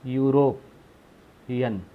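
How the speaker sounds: "European" drawn out very slowly; slow drawn-out word. Shown as a demonstration. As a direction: stretch "European" so they hear the य sound. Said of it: "example ले लेते हैं". ("European" को बहुत खींचकर धीरे बोलना)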